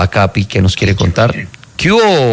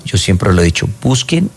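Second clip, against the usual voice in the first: ordinary talk, nothing marked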